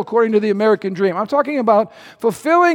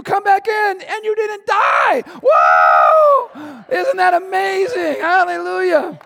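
higher, exuberant shouting of faith; excited preaching; 7 semitones higher than usual. Acted. Preacher excitedly flipping the fearful what if into faith. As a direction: lift the room with joyful what ifs.

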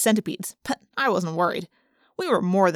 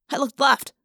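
usual speaking voice

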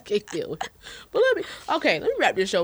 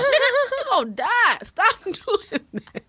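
higher, laughing warning, slowing at the end; laughing mock scold; 13 semitones higher than usual. Mom retells warning her son about salt, laughing. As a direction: scold the kid through a laugh.

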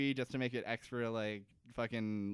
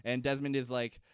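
ordinary talk

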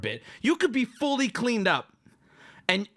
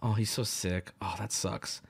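low, soft mock sympathy; sympathetic bystander; 11 semitones lower, 5 dB quieter, slower than usual. Bystanders watching him vomit, hushed sympathy. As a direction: sigh it softly and pityingly. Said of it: "people would have been like".

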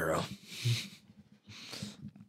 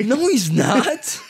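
laughing denial; 12 semitones higher, 3 dB louder, much slower than usual. Real, not acted. Laughing disbelief.

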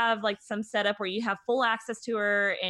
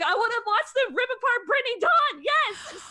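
much higher, louder gleeful cheer; excited cheer; 6 dB louder than usual. Retold or imitated. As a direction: squeal it like a gossip fan finally getting the drama. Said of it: "'cause I'm like".

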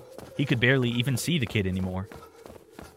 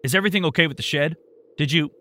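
higher, louder, fast anxious questions; full volume, anxious; 6 semitones higher, 5 dB louder, faster than usual. Acted. Uncle Rick on the phone, panicking about his shed, loud and fast. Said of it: "returning to full volume".

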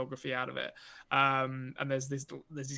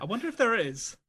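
higher, mock-pondering echo of the co-host; a playful higher parody of a friend's musing; 8 semitones higher, 5 dB louder than usual. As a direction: tease him by parroting his wonder. Echoing co-host's musing, playfully.

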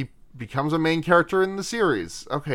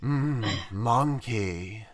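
slow, low, soft surfer drawl; lazy surfer, drawn right out, quiet; 5 semitones lower, 8 dB quieter, much slower than usual. Pretend. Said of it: "You gotta do it in the surfer voice".

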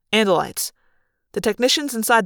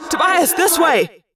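high, raised call to a friend; urgent yell; 8 semitones higher, 4 dB louder, slower than usual. A narrator's reading. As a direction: yell to guide him to safety. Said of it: "I yelled".

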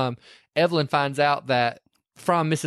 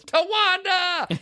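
very high, slow, drawn-out war cry; battle cry; much slower than usual. Retold or imitated. Retelling Evelyn ramming the car, a long high war cry. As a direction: stretch it into a wild rallying yell.

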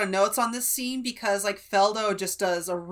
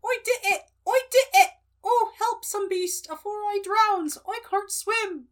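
very high, hammed-up cry for help; pretend fear of drowning; 11 semitones higher than usual. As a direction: wail in mock panic as a comic rustic diver. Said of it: "pretending to be afraid of drowning".

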